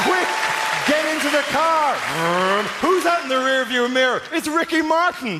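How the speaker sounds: high urgent chase shout; hammed-up chase panic; mock action panic. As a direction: yell like it's a car chase. Action movie chase voiced.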